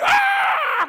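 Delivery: long, high, loud yell; imitated scream. Pastor imitating a caller's house visit gone wrong, screaming. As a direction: imitate a piercing scream over the phone. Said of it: "Começou a gritar assim".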